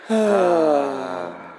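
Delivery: audience sighs on cue; long exaggerated group sigh; group mock sigh. Campers sigh at widow's name.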